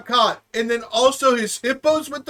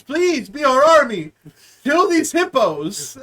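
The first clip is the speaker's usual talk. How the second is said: mock government plea; 4 semitones higher, 6 dB louder than usual. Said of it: "employing the citizens".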